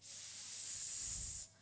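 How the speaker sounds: sustained snake-like hiss; long S hiss. Shown as a demonstration. Teacher moves hand like a snake, long hiss. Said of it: "move your hand like a snake".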